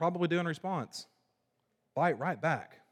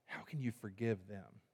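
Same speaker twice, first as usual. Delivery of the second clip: much softer, hurt question to God; hushed complaint; 5 semitones lower, 14 dB quieter than usual. Retold or imitated. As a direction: murmur it like a wounded grievance. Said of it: "and we go".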